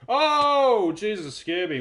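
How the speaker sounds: sudden loud high yelp of fright; startled. Real, not acted. Streamer startled by a viewer's message, loud real yelp. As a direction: jump out of your skin.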